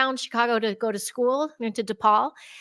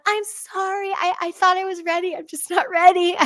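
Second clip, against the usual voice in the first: higher, sheepish apology through the window; apologizing to the being, higher and sheepish; apologetic to the presence; 8 semitones higher than usual. Retold or imitated. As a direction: say sorry like a shy kid backing out. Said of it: "I went".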